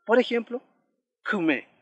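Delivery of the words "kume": kume with a subtle vowel coloured by the K; vowel after consonant. Shown as a demonstration. Teacher gives an example after a consonant, careful model of kume with subtle vowel. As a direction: demonstrate kume, first vowel nearly swallowed by the K. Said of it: "toma ese sonido".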